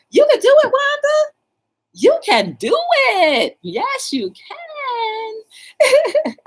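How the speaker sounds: very high, loud cheer with a catch. Acted. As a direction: scream encouragement at Wanda. Cheering Wanda on.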